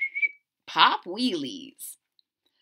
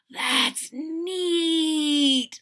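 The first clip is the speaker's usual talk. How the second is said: higher, wide-eyed praise; impressed boy; 7 semitones higher than usual. Acted. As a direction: admire the cookies. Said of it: "said Chester".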